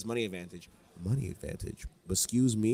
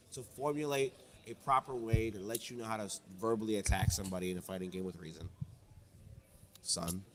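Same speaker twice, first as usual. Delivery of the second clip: ordinary delivery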